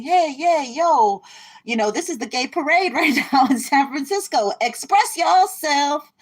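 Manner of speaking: high, loud, giddy cheer; cheering them on, sky-high and bubbling; spirit voice, excited. Pretend. Spirit urges the seeker to express herself, high excited cheer. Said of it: "So Spirit's saying".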